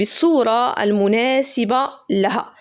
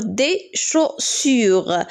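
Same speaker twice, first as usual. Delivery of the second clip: slow syllable reading; much slower than usual. Shown as a demonstration. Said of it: "et deux S U".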